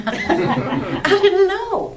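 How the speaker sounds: slow words through real laughter; laughing. Real, not acted. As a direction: laugh at your own innocence. Laughing at own naivety.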